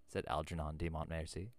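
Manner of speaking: usual speaking voice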